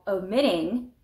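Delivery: omitting, slowly, leaning on MIT; stress on second syllable. Shown as a demonstration. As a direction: draw out "omitting" with the beat on its middle. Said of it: "the accent is on omit".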